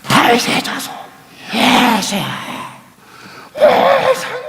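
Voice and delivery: high, strained shouted plea; 큰 소리로 외침. Shown as a demonstration. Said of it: "큰 소리로". (높은 목소리로 목청껏 외치기)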